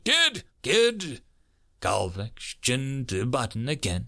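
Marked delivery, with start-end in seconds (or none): 0.0-1.2 high-pitched urgent calls; shouting. A narrator's reading. Major trying to reach the kid as danger nears, shouting. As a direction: call out sharply to get through to someone. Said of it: "the major shouted".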